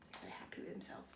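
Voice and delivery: soft whispered aside; a quiet whispered aside. Real, not acted. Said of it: "whispered aside to class".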